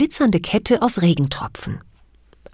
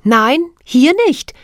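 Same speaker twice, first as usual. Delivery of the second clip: put-on higher, louder, slow girl's reply; young girl answering; 8 semitones higher, 6 dB louder than usual. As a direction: answer firmly as the little girl. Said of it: "antwortete Mona".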